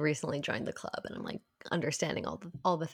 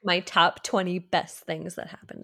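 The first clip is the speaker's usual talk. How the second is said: louder, smug bragging parody; mocking bragging voice; 6 dB louder than usual. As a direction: show off like a smug poster. Mocking bragging social posts.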